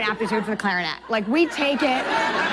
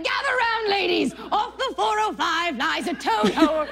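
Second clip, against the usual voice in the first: mock town crier; 7 semitones higher than usual. Comedy clip, acted announcement.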